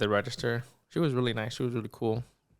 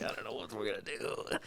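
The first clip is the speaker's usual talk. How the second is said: much softer, whispered worry; panicked whisper; 9 dB quieter than usual. Retold or imitated. Thinking in his head about the lost wallet, whispered worry.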